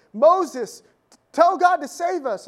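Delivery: desperate cry. Retold or imitated. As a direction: cry out as if bitten and dying. Snake-bitten people crying to Moses.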